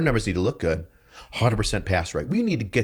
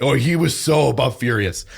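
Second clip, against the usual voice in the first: normal talking voice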